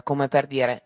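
ordinary delivery

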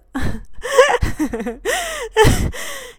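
high, loud hysterical laugh; wild high laughter. Acted. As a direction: break into a high unhinged cackle.

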